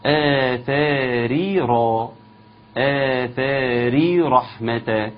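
آثاري with a thin ri, then رحمة with a heavy ra, slowly. Shown as a demonstration. Teacher showing the student the thin and heavy ra, slow. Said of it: "عاوز أسمع إيه".

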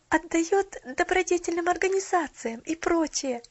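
high, loud rushed insisting; hurried eager talk. A narrator's reading. Girl insisting to shopgirl, hurried. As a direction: insist in a rush. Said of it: "торопливо разговаривая".

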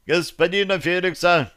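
high, brisk call; brisk cheerful. A narrator's reading. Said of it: "бодро ответил Остапий".

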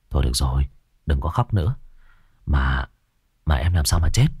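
put-on calm a crying child down; gentle comforting. A narrator's reading. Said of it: "cúi xuống nói với thằng bé". (giọng trầm nhẹ dỗ dành đứa bé)

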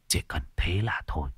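whisper. A narrator's reading. Unvoiced whisper.

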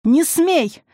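high, loud cry of warning; desperate yell. A narrator's reading. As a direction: shriek to stop her. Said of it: "завопила она".

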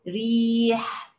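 ريح drawn out very slowly, its light ra held; slow model reading of ريح; tajweed demo word. Shown as a demonstration. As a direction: stretch ريح out slowly to show the thin ra. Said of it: "زي كلمة مثلًا ريح".

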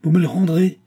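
cried out high and loud, stress on me; incredulous cry. A narrator's reading. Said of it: "s'écria le commis".

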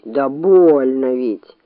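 slow, low, pained whine; sorry bear complaint; bear crying out. A narrator's reading. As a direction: moan it softly drawn out, as a hurt bear. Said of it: "закричал опять медведь".

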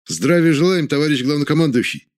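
loud, high, crisp salute; military chorus greeting. Acted. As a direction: bark it out like soldiers at attention. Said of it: "хором ответила троица".

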